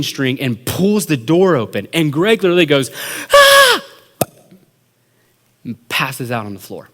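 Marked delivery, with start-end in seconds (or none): 3.3-3.8 high, loud terrified scream; short shriek of fright, hammed up. Friend screaming as the door opens, shrill fright.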